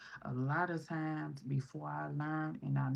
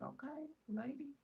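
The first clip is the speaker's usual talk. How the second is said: awkward okay lady; 12 semitones higher, 8 dB quieter than usual. Retold or imitated. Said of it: "I was like".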